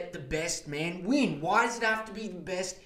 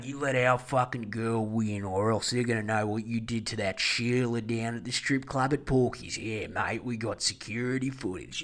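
lower, rough Aussie heavy making a threat; thug blackmailer; 9 semitones lower, 6 dB quieter than usual. Retold or imitated. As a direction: growl it like a shady church enforcer. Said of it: "so they have to blackmail him saying".

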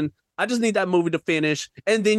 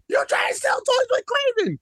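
much higher yell, stress on you; shrill escalated shout. Real, not acted. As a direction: push the repeat way up. Second take, even higher.

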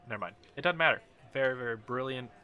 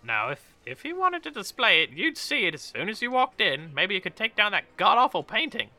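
high, louder scoffing character voice; catty tavern woman; 9 semitones higher, 8 dB louder than usual. Acted. Woman mocking the baron's display, high and catty. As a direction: scoff at the host in a raised voice.

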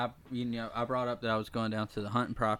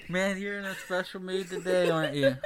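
laughing; 6 semitones higher than usual. Real, not acted. Speaking through laughter.

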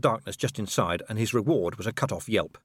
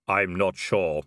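sombre, even otherworldly voice; Death; 3 semitones lower, slower than usual. Acted. Robed figure admits doubt to Vimes, solemn Death voice. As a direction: answer in a solemn level tone.

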